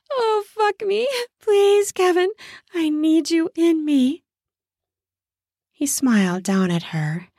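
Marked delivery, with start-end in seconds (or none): 0.1-4.2 begging woman. Acted. Woman begging her lover Kevin, high and pleading. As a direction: beg him in a high voice.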